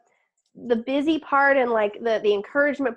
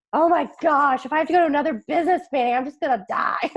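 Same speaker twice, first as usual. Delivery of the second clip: mock exasperation; 4 semitones higher than usual.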